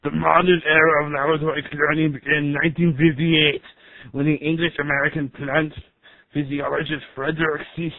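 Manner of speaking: computer narrator in an Austrian strongman voice; Arnold Schwarzenegger. Acted. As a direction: narrate science facts like an action hero. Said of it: "you guys got the rights to Arnold Schwarzenegger's voice?".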